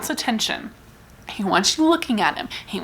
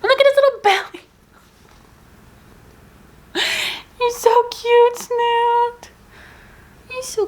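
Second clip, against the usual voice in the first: much higher, sing-song cooing; 13 semitones higher than usual. Real, not acted. Greeting her pet Snoot, high cooing baby talk.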